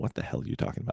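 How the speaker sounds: unvoiced, very soft retold confusion; whispered quote. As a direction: whisper it like a puzzled stranger. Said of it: "sitting there going".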